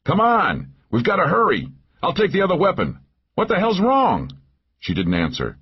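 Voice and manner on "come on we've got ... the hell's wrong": high, pressing shouts; alarmed, raised-pitch urging; urgent yelling. A narrator's reading. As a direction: yell to hurry someone along under fire. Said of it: "I yelled again".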